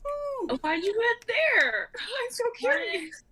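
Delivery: ordinary talk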